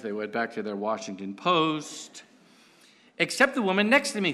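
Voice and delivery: ordinary talk, nothing marked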